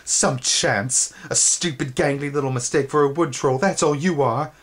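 high, jeering insult; mocking taunt. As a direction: sneer it down at him. Taunting voice remembered by Twig.

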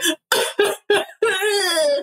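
high imitated crying wail; mock sob. Showing Joseph was really crying, an imitated sob. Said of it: "But it's more just like a".

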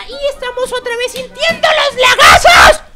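rising into a cry of frustration; frustrated outburst. Real, not acted. Gamer cursing the lag, frustrated yell. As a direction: vent at the lag, voice breaking up. Lag ruins his game.

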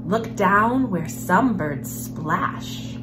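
ordinary delivery, nothing marked

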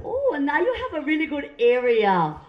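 cooing imitation of a Vietnamese nail lady; Vietnamese accent. Imagined gynecologist complimenting patient, Vietnamese accent. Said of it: "I love their accent".

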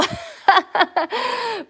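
a loud, high laugh; high burst of laughter. Real, not acted. Laughing at her own clogs joke for the viewers.

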